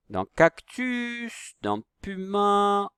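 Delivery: high, slow, with a held "u" in "cactus"; sing-out, drawn-out classroom pronunciation; stretched target vowel. Shown as a demonstration. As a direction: hold the vowels long so learners hear the sound. Teacher stretching the U sound.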